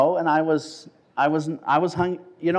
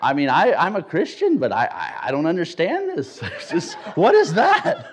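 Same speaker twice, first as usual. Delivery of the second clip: louder, laughing bafflement, very high at the end; baffled protest, laughing; 5 semitones higher, 4 dB louder than usual. Retold or imitated. Young man confused at church, laughing. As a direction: tell the church you don't get it. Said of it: "I said".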